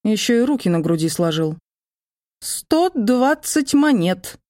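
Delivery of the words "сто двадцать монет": high, slow nervous squeak. A narrator's reading. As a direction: squeak it out like a jittery elf. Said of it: "пропищал мой эльфийский спаситель".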